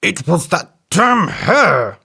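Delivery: slow, grudging elephant voice; an elephant working out he was tricked; a lightly voiced cross elephant; grumbling elephant. A narrator's reading. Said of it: "said the elephant".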